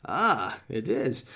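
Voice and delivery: high swooping "Ah", exaggerated; mocking wife's voice. Husband likening his wife to Littlefinger, mocking impression. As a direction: send her up as a knowing schemer. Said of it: "that's the way she speaks".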